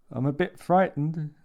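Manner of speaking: frightened and close to tears; a hint of fear in a thick voice. A narrator's reading. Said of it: "said Paul in a thick voice".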